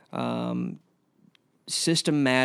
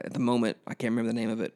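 normal talking voice

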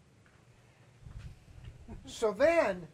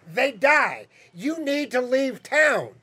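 louder, insistent acted parents' complaint; exasperated demand; 4 semitones higher, 7 dB louder, much slower than usual. Upset parents ordering Joseph's family out, exasperated demand. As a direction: act out parents laying down an ultimatum.

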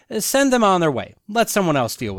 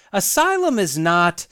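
usual speaking voice

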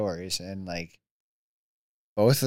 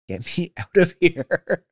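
laughing plea; 9 semitones higher, 8 dB louder, slower than usual. Real, not acted. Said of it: "restless, stuck on couch".

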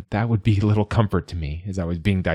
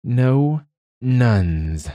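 higher, lightly voiced dragon hiss; hissed dragon refusal; 5 semitones higher than usual. A narrator's reading. Dragon rejecting the nun offer, hissed. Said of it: "then hissed out two words".

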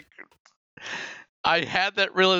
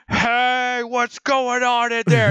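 higher, hammed-up hailing through a megaphone; mock loudspeaker call; 9 semitones higher, 3 dB louder, much slower than usual. Imitating a stranger at the front door with a loudspeaker. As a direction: call out like a cop at the door, playfully. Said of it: "with a loudspeaker".

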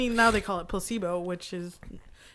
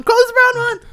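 much higher, louder shriek; shrieking yell; 11 dB louder, faster than usual. Real, not acted. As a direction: scream it out high. Louder repeat.

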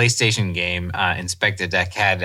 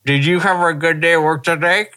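higher, mumbled mouthguard voice; talking through a night guard; 6 semitones higher than usual. Retold or imitated. Husband chatting to his wife with a night guard in. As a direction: say it around a plastic guard in your teeth. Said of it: "talk to my wife and I'm just like".

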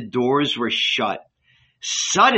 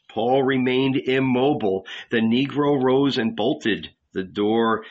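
ordinary delivery, nothing marked

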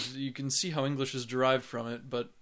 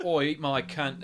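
slow, base Cockney woman; Cockney accent; 3 dB louder, slower than usual. Shown as a demonstration. Co-host contrasting dialects for laughs, Cockney accent. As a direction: put on a rough Cockney accent. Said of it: "your Cockney and your".

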